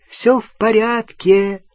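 high, slow soothing voice; calm him gently; reassuring. A narrator's reading. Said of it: "ободряюще сказала Полумна".

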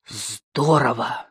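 slow, drawn-out wonder; low, lingering awe; heartfelt admiration. A narrator's reading. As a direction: savour the word with feeling. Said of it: "с чувством произнёс Шамбамбукли".

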